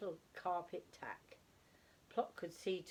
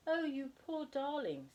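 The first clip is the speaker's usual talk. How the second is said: lightly voiced gentle young woman; sweet young voice; 9 semitones higher, 4 dB louder than usual. A narrator's reading. A young lady comforts the fallen owlet, sweetly. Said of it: "said sweet young voice".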